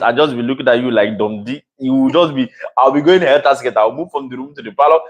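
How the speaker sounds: ordinary talk, nothing marked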